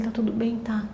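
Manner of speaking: voiceless hushed question; whisper. Retold or imitated. Mother checks on her daughter, whispered. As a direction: breathe it so nobody else hears. Mother asking softly at home.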